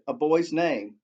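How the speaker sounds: usual speaking voice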